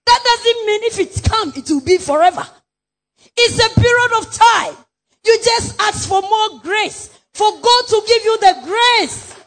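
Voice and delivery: forceful preacher shouting; preaching. Acted. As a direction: preach it out loud.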